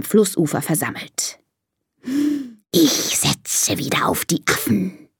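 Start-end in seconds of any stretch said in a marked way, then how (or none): 2.7-5.1 slow, sibilant snake voice; hissing snake. Acted. Snake betting after the monkey show, slow hiss. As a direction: hiss it slowly, stretching the sounds. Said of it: "zischt die Schlange".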